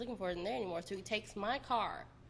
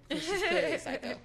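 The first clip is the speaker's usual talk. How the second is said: laughing; 7 semitones higher, 6 dB louder, faster than usual. Real, not acted. Laughing telling of jail joke.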